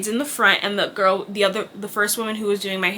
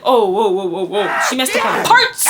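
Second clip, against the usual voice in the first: alarmed. Retold or imitated. Retelling the alarm at the salon.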